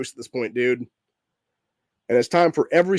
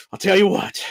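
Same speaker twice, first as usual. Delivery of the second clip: Southern drawl; 3 semitones higher than usual. Acted. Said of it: "start out with that weird-ass Southern drawl".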